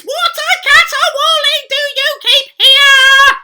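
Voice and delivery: shrieked very high and very loud, slowly; an outraged maid yelling over a drunken late-night party; screeching at the top of her voice. Acted. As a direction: scream it over the noisy singers. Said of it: "screeching at the top of her voice".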